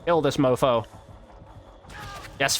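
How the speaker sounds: normal talking voice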